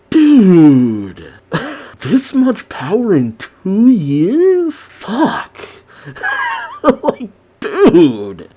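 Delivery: high, drawn-out amazed exclamations with laughs; floored disbelief. Real, not acted. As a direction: stretch the words out in giddy shock. Floored by the character's power.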